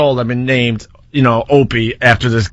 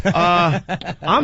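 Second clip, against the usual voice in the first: imitated tongue-tied hesitation, a little softer; awkward stammer; 3 semitones higher, 3 dB quieter than usual. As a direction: stumble awkwardly, acting out meeting your idol. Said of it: "all awkward".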